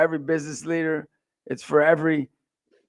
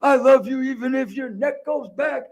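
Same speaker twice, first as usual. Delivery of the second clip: put-on higher cooing to a baby; a hint of new-dad baby voice; lightly voiced baby talk; 8 semitones higher than usual. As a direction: coo to the newborn, a touch higher. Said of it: "I grab his neck".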